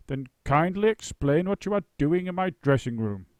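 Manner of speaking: loud booming demand. A narrator's reading. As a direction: boom it at the bear. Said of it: "boomed the great actor".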